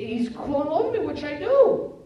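put-on high, loud child's appeal; imitated boy's plaintive question; distressed young son. Acts son appealing to father.

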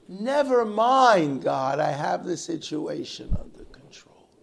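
high, loud, drawn-out start easing down; breezy dismissal. Retold or imitated. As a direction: wave off the help, suddenly self-assured. Man brushes God off after prayer.

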